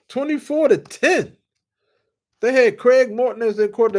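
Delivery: a fan still thrilled decades later; elated recounting. Real, not acted. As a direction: savour the victory.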